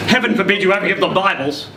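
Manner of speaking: ordinary delivery, nothing marked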